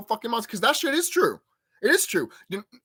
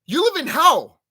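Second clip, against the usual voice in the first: higher, louder declaration; insistent shout; 9 semitones higher, 7 dB louder, slower than usual. Real, not acted. Lecturing viewers about their comfort, raised insistent. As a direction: hammer the point home.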